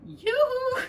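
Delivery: very high, loud sing-song call. Acted. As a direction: call out gaily across the garden. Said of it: "she called".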